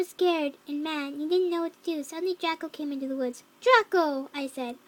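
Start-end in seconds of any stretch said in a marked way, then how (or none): 3.6-4.3 loud call of a name. Acted. Girl seeing Draco come into the woods, calling out. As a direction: call to someone just spotted.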